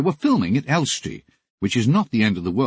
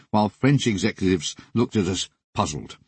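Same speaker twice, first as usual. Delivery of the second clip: plain reading voice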